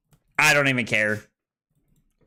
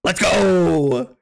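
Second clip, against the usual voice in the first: loud, high victory cry; triumphant shout; 5 semitones higher, 5 dB louder than usual. Real, not acted. Streamer cheers on the lucky roll, triumphant shout. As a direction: shout it out, still pumped from the win. Celebrates rolling a six.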